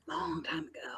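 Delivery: breathy whispered aside, very soft. Real, not acted. Teasing the young host about her age, whispered. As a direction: whisper it like a secret.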